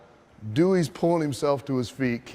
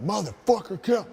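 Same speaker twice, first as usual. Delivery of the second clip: high mock heckle from the crowd; high, indignant retold yell; irate audience member; 7 semitones higher than usual. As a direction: imitate an angry fan demanding a count. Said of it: "Another guy stands up".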